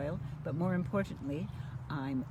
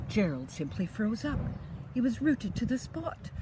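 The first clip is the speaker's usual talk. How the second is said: lively storybook read-aloud; reading to children; 5 semitones higher, 4 dB louder than usual. A narrator's reading. Grandma reading a bedtime story, lively and sing-song. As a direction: bring the rhymes alive for little ones. Reads picture book to children.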